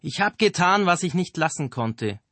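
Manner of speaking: high, loud yell after them; shouting. A narrator's reading. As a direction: shout it across the water. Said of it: "schrie er ihnen noch hinterher".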